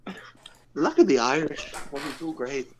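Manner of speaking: clumsy, slow put-on Irish brogue; a poor attempt at an Irish lilt; bad Irish accent. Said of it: "I never thought your Irish accent would sound worse than mine".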